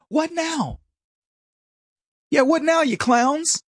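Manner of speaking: high, mocking challenge; taunting. Taunting those who trusted the science.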